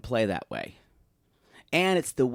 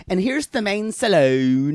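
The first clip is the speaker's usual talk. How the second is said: Daisy impression; 6 semitones higher, 5 dB louder than usual. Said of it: "I love it when she says".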